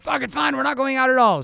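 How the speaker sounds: husband storming off the date plan, angry; angry outburst. Retold or imitated. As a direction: throw up your hands in a huff. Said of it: "even said".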